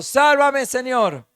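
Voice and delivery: sinking Peter screams for the Lord; cry for help. Acted. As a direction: shout it as a man sinking in the waves. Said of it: "gritó".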